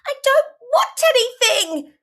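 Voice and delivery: high, loud tantrum voice; acted little-kid cry of protest; child crying out. A narrator's reading. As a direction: wail it like a child mid-tantrum. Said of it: "you cry and kick off".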